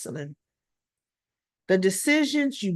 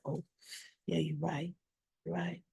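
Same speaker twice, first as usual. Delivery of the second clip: much softer, deflated concession; sheepish agreement; 4 semitones lower, 15 dB quieter than usual. Retold or imitated. Christina concedes to her lawyer sister, softly sheepish. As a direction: quietly back down, caught out. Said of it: "And she's like".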